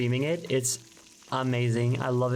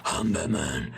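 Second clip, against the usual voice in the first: low, gravelly Batman growl; mock-gruff superhero rasp; gravelly movie Batman; 7 semitones lower, slower than usual. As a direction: growl it like a parody of the Nolan Batman. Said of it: "the way he's like".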